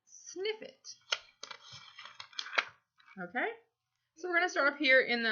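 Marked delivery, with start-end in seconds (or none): 0.1-0.9 high, soft sniff it; sniffing sound. Shown as a demonstration. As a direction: act out a dainty sniff. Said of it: "bend down to sniff it".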